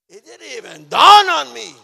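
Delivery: high, booming preacher's cry; exclaiming in amazement. Real, not acted. Preacher telling church of first reading aloud, amazed shout.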